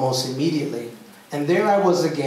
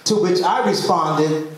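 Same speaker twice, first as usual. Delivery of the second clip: normal talking voice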